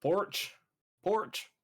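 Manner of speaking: porch, Kiwi-style. Shown as a demonstration. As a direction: say porch the New Zealand way. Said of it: "Sounds like porridge".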